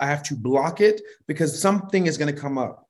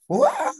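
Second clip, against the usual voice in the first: high yelp. Retold or imitated. Sudden panic at a rush job.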